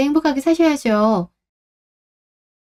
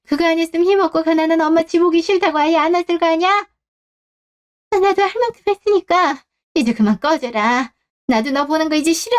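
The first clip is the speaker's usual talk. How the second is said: higher, fast exasperated scolding; mother drives her son away, higher and fed up; fed-up rebuke; 6 semitones higher than usual. Acted. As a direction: finally tell him to get lost.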